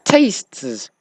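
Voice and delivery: tastes with the final st-s cluster spelled out carefully; tricky plural tastes. Shown as a demonstration. A French speaker showing which English plural trips him up: tastes. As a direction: say tastes slowly, hitting every consonant. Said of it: "words I can't pronounce in English".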